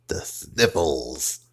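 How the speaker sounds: slow deep ominous diagnosis; dramatic low. Acted. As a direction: announce it like grave news. Doctor repeats diagnosis.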